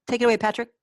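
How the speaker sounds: normal talking voice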